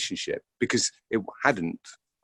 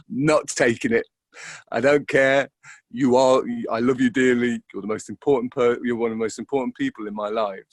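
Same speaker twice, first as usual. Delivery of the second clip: firm, raised refusal; 3 semitones higher, 5 dB louder than usual. Retold or imitated. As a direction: tell a friend you won't take it, voice raised. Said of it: "I've just kind of gone".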